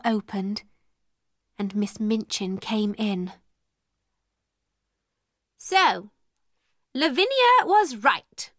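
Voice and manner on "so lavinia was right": high, swooping indignation; angry. A narrator's reading. Miss Minchin catching the girls at their party, angry. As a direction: pounce on it as an accuser. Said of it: "she said angrily".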